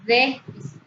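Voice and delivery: Déficit with a loud DÉ, the rest falling away; stress on first syllable. Shown as a demonstration. Teacher repeating the example, Déficit stressed hard on DÉ. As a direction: punch the DÉ of Déficit. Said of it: "una mayor intensidad de pronunciación".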